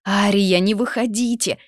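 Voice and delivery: loud, high call after her; shouted warning. A narrator's reading. Maid calls after her mistress, loud and high. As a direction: cry out to stop her. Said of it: "выкрикнула София вслед".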